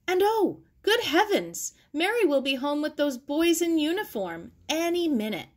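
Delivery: a sudden panic about being unready; flustered surprise. A narrator's reading.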